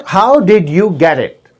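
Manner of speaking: "how did you get it" slow, with did and you distinct; separate words. Shown as a demonstration. Teacher showing the wrong model, carefully separated. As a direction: give each word in "how did you get it" its full sound.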